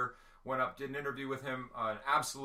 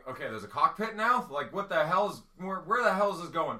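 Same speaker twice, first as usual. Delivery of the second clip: higher, louder mock bewilderment; baffled adult reaction; 5 semitones higher, 7 dB louder than usual. Adult viewer reacting to the wrestling promo in his head, baffled. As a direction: voice an adult's exasperated confusion. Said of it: "like, as an adult, you're going".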